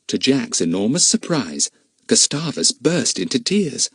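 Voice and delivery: plain reading voice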